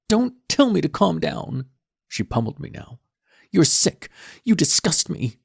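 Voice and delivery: put-on high, loud furious teen girl; raging daughter. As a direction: hurl it at him in disgust. Daughter screams at father.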